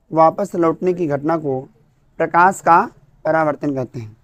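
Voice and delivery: measured, word-by-word dictation pace; slow dictation. Acted. Teacher dictating the definition to students, slow and measured. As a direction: spell it out slowly so the class can write.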